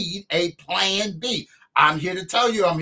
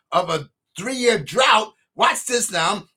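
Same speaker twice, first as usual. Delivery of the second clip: usual speaking voice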